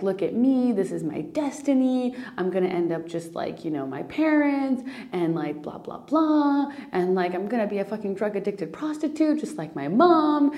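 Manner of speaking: mock self-pitying rant with pitch leaping up; self-critical spiral. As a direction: play an inner critic working itself up. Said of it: "let's go to the spiral here".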